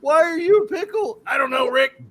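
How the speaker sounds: high-pitched cartoon duo impression; Rick and Morty. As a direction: keep it high and frantic, stumbling into the correction. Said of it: "and Rick and Morty".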